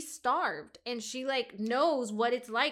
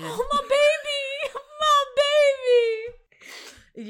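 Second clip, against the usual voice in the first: mock sobbing; 8 dB louder than usual. Host pitying Lucien, in a hammed-up high wail. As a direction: cry over him like a mother.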